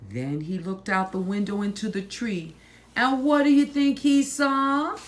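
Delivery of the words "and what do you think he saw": put-on high, loud rising question to children; excited question. A narrator's reading. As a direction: build suspense for the kids. Storyteller asks children.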